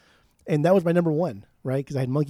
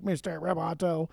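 slow, mechanical robot imitation; 5 dB quieter than usual. Imitating a singer's modulated vocal for cohost, robot voice. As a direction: stretch it out like a robot. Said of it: "modulates his voice to sound like a robot".

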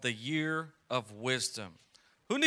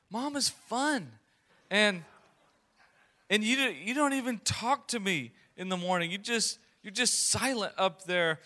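light imitation of daughter; 6 semitones higher than usual. Nine-year-old daughter voiced complaining.

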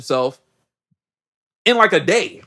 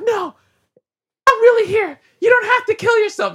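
much higher, louder falsetto Bella; mock Bella; 3 dB louder than usual. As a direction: squeal it in a hammed-up girly voice. Bella reassures Edward in Italy.

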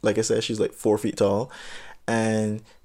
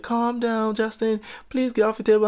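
higher, pleading adult voice; mock chaperone; 9 semitones higher than usual. The chaperone pleading with Justin on the table, pitched higher. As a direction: beg him down like the chaperone.

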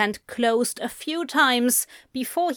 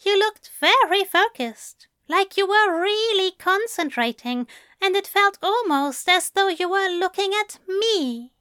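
higher, gentle English gentleman; Aziraphale voice; 9 semitones higher than usual. A narrator's reading. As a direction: lift the voice for the fussy angel.

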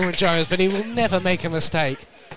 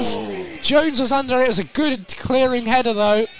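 excited commentary; 6 semitones higher, 5 dB louder than usual. Real, not acted. Commentator calls a goalmouth scramble.

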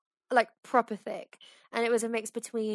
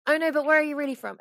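louder, higher insistent repeat; insistent prying quote; 6 semitones higher, 7 dB louder, faster than usual. Retold or imitated. Stranger repeating the question to him again, louder and insistent. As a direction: demand the answer, more forcefully this time. Stranger pressing a third time.

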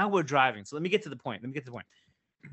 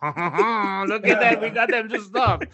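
louder, slow, put-on gloating voice; mocking Quebec officials; 5 semitones higher, 7 dB louder, slower than usual. Storyteller imagining Quebec officials laughing at stopped drivers, smug gloat. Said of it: "they just want you to stop for the hell of it".

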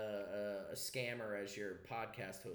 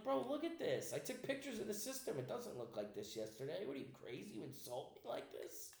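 much higher, indignant mock Italian accent; 8 semitones higher than usual. Mocking the garage sale buyer, in an over-the-top Italian accent. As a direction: play an offended Italian uncle, hammed-up Italian accent. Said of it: "Now I'm Italian".

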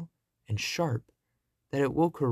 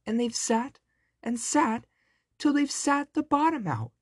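shrill little bear voice, very high; high-pitched baby bear squeal; squeaky baby bear; 12 semitones higher than usual. A narrator's reading. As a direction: squeak it like a tiny indignant bear cub. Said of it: "squeaked the baby bear in his shrill little voice".

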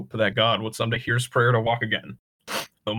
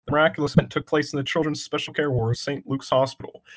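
ordinary delivery

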